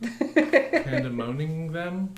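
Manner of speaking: milk the pun, stretch every syllable; emphatic pun. Shown as a demonstration. Pushing the pun on the cohost, slow and loud. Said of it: "pun repeated emphatically".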